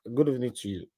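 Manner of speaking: ordinary talk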